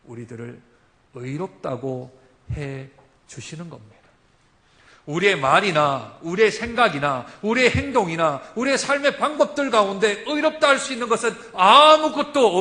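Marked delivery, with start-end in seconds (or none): 5.1-12.6 loud, rising sermon cry; full-force preaching. Acted. Preacher proclaiming to the congregation, loud. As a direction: preach it out to the whole church.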